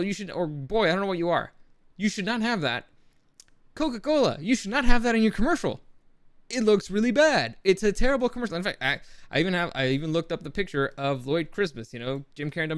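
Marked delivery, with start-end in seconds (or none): 2.0-8.6 loud, high scolding of a brand. Real, not acted. Vlogger scolds Coca-Cola over its commercial, loud and indignant.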